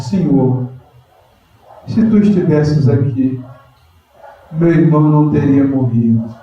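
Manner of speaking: Mary kneeling at Jesus's feet, humble plea. Acted. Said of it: "E fala com humildade".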